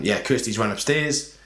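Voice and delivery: ordinary talk, nothing marked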